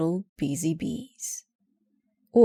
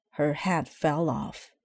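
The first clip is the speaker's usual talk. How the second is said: usual speaking voice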